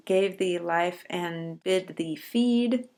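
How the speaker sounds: slow, measured line, each beat even; slow syllable reading. Shown as a demonstration. Teacher showing the class the seven-beat rhythm, slow. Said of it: "seven syllables".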